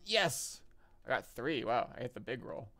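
loud happy shout; excited cheer. Real, not acted. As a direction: celebrate the lucky roll. Wins big dice roll.

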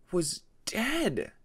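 plain reading voice

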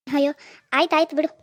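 ordinary talk, nothing marked

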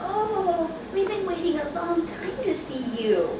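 cooing. Real, not acted. Grandma speaks to newborn.

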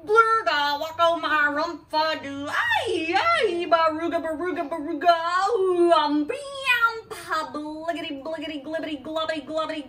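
loud gibberish burst; silly sounds. Acted. Mom reading to Harper, loud goofy gibberish. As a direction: spit out nonsense syllables with gusto. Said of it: "make silly sounds like".

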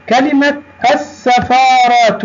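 السفارات with silent lam and doubled s; high-pitched teacher demo, hold the s in السفارات; sun lam. Shown as a demonstration. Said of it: "لام شمسية". (نطق «السفارات» بلام صامتة وسين مشددة)

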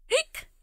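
sharp high hiccup squeak. Acted. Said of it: "[name] by [name]".

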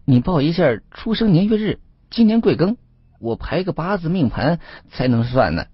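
high-pitched, bluffing fortune-teller; mildly rattled, voice jumping up; a little nervous. A narrator's reading. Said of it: "吴老三有点紧张了起来".

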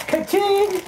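high, bright cash-register call; playful ka-ching. Pretend. Showing off the gifts, high playful cash-register call. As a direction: ring it out like a jackpot.

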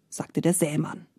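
usual speaking voice

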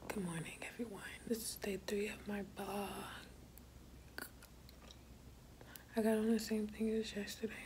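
soft whispered vlog talk; whispering. Real, not acted. Whispered vlog, child asleep.

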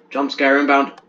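loud announcer-style warning; playful loud heads-up; mock alarm. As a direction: call it out like a trailer voice.

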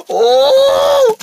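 long, high "oh" after a gasp; gasp, then hold a high cry; startled cry. Acted. Mimicked speaker testing the copying pigeon, startled high cry.

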